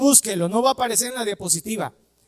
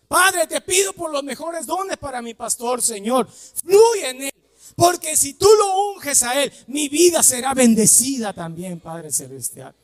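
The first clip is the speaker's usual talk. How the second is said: fervent demo prayer; 4 semitones higher than usual. Shown as a demonstration. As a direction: pray it out with growing fire. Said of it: "Pedid por los mejores dones".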